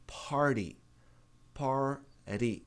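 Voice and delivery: "party" with a tapped T, like "pardy"; "party" the American way, the T flapped. Shown as a demonstration.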